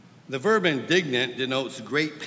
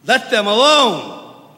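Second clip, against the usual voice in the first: loud, stern rebuke, slow and higher; stern command; 7 semitones higher, 12 dB louder, slower than usual. Retold or imitated. Preacher voicing Jesus rebuking his apostles, stern loud command. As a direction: bark it out like a stern master. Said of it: "I can imagine it was pretty straightforward and stern".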